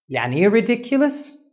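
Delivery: ordinary talk, nothing marked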